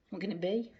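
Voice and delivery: ordinary delivery, nothing marked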